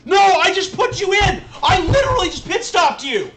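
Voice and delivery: loud, high angry yell; furious shout. Real, not acted. Streamer to his race engineer over the radio, furious shout. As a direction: yell it at the screen.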